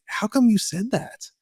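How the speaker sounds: softly asking his little daughter; gentle question. Retold or imitated. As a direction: ask it tenderly, at her level. Kneeling to ask his daughter.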